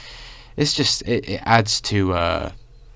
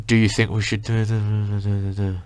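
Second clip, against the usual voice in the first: trailing off. Retold or imitated. Guy starting to talk to his group, trailing off. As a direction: start a question, then fade into mumbled nonsense. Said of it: "he, like, trails off everything he says".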